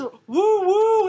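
rising hooting woo; walrus voice. Retold or imitated. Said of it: "he says".

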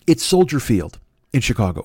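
ordinary delivery

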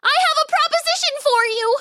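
very high, squeaky mouse voice; high squeak of a small creature; crying-out tiny mouse. A narrator's reading. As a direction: plead for attention as a tiny mouse. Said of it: "cried the tiny mouse".

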